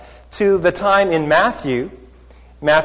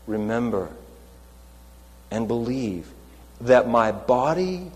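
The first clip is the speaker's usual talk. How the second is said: solemn invitation; 3 semitones lower, 7 dB quieter than usual. Retold or imitated. Jesus at the Last Supper.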